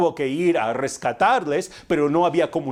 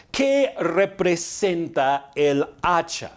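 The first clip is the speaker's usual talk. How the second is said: each word of "¿Qué representa el hacha?" drawn out slowly; slow rhetorical question, word by word; slow, deliberate question; slower than usual. Real, not acted. Question repeated deliberately for the congregation.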